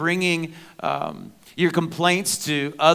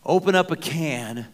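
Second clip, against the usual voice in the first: preaching appeal; 4 semitones higher, 5 dB louder than usual. Acted. Appeal to the congregation.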